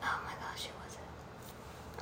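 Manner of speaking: embarrassed whisper to herself; hushed, slow and flustered. Real, not acted. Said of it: "This is so embarrassing".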